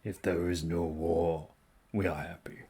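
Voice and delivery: low, calm, deliberate; stoic warrior. Acted. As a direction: say it plainly and grave. Voices a stoic ally.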